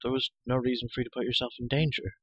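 ordinary delivery

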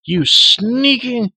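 put-on enraged boy shaking the creature; furious slow shout. A narrator's reading. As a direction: spit each word out in rage, cut off. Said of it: "Terran cried furiously".

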